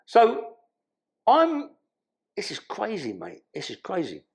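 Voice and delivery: ordinary talk, nothing marked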